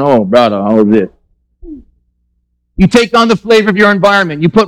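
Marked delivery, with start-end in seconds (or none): 0.0-1.1 low, groaning disbelief; exasperated. Retold or imitated. Locals reacting to the mixed accent, exasperated. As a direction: sigh it like you can't believe it. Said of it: "We're just, like, going".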